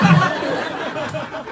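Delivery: high laughing disbelief; stunned. Retold or imitated. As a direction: react in shocked disbelief. Stunned at dad's fix.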